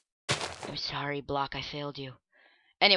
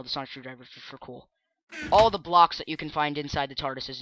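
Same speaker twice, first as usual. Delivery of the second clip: ordinary talk, nothing marked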